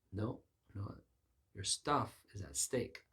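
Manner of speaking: ordinary delivery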